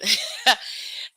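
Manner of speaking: airy unvoiced chuckle; breathy laugh. Real, not acted. Host laughing at her own long introduction, airy chuckle.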